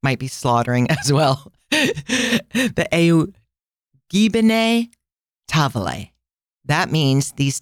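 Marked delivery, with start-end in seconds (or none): 1.7-6.1 careful, halting attempt at Italian; Italian pronunciation. Shown as a demonstration. Host attempts the tablets' name for listeners, Italian accent. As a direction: sound out the Italian name piece by piece. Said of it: "gonna say it in Italian".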